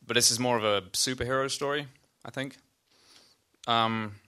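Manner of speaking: talking while chewing popcorn; words around a mouthful of popcorn; munching popcorn. Real, not acted. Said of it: "I'm eating popcorn right into the mic".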